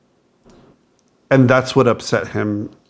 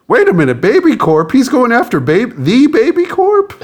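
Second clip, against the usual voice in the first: high, loud mock panic, climbing higher; imitating alarmed child; 12 semitones higher, 4 dB louder than usual. Parent imitating son who believes Baby Corp is real, alarmed. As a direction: squeal it like a kid taking the threat seriously. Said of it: "But him, he's like".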